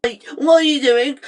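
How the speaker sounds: ordinary delivery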